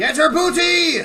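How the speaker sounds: high, loud Irish pirate shout; hammed-up Irish pirate; Irish pirate accent. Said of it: "You sound Irish".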